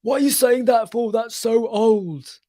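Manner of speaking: annoyed coworker. Retold or imitated. Retelling how office colleagues complained, whiny irritated coworker voice. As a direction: play the fed-up colleague whining. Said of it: "really irritate some people".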